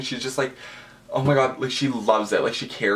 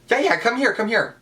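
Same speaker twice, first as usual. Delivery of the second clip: much higher, bubbly imitated invitation; imitating eager welcome; 12 semitones higher than usual. [name] inviting fans for pictures, imitated in a high bubbly voice. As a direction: play the star waving fans over, squeaky and keen. Quoting star inviting them over.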